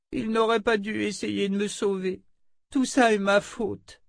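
put-on a child blaming herself, close to tears; guilty little girl. Oli blames herself for Warren's death, high tearful child.

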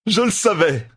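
high, triumphant exclamation; exclaiming. A narrator's reading. Matt to the bodyguard he has found out, exclaiming. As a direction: blurt it out as a gleeful discovery. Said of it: "s'exclama-t-il".

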